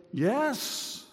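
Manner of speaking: sudden high indignant yes; my own defiant yes. Retold or imitated. Pastor voicing his own angry answer, a high defiant yes. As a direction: snap back a high, self-justified yes. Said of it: "I want to say".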